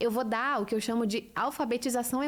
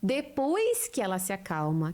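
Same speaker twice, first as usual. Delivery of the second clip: normal talking voice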